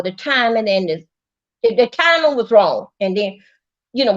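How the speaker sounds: normal talking voice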